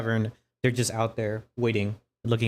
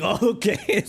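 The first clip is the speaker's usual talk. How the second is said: squeaky laughing "oh okay"; 11 semitones higher than usual. Retold or imitated. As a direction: laugh through it, pitched up. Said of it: "I'm like".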